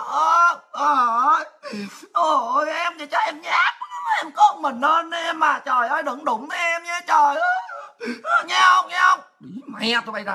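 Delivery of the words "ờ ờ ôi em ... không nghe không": high, hammed-up pleading cry; mock frightened wailing. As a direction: fake terror, begging in a raised voice. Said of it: "em sợ dữ lắm á". (giả bộ sợ hãi, kêu van cường điệu)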